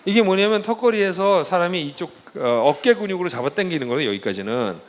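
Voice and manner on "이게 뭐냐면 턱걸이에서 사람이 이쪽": ordinary talk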